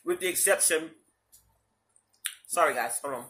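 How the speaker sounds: muffled behind a tissue; speaking into a napkin; talking through a napkin. Real, not acted. Seen 0: napkin held over mouth about 0.1–1.9 s, then lowered.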